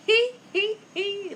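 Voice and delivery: slow, high, loud hee-hee; laughing waves. A narrator's reading. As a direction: giggle high and drawn-out. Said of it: "laugh the splashing waves".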